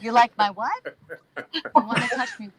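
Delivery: loud, incredulous mock question; laughing, disbelieving request to repeat it; baffled reply. Panelist mishearing a whispered lover, loudly incredulous. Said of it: "you find yourself saying".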